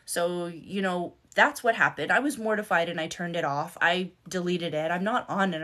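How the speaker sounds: ordinary talk, nothing marked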